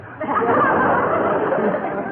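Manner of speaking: high laugh after the punchline; hearty laugh. Acted. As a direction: laugh at her own joke. [name] laughs at her own joke.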